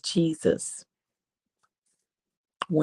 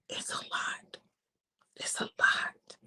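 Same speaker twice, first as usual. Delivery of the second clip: hushed, breathless whisper; 9 dB quieter, slower than usual. Acted. Jesus whispers his burden to the Father. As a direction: let it slip out barely voiced.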